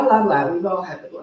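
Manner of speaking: ordinary delivery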